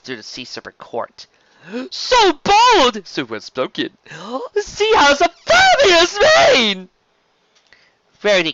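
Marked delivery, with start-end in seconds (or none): 1.9-3.1 loud, high-pitched gushing; admiring exclamation. Acted. Sea serpent court marveling at the pony, gushing. 4.1-6.9 loud, high, slow drawn-out gush; gushing admiration. Acted. Sea serpent admiring the pony's mane, extravagant gush.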